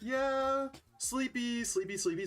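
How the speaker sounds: high, drawn-out sleepy whine; sing-song sleepy yawn. Pretend. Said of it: "So I'm just like".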